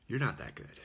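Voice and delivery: put-on scoffing voice; mock dismissive retort. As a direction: toss it off like a skeptic. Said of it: "And we tell God".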